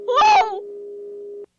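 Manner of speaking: loud shocked whoa; startled shout. Real, not acted. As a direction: jolt back in shock. Scare in horror game.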